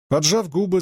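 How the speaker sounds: ordinary delivery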